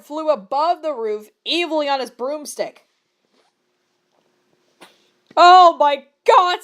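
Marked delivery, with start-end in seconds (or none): 5.4-6.6 loud, slow, shocked exclamation; slow shocked outburst, much louder; gasped. A narrator's reading. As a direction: gasp it out in horror, stretched.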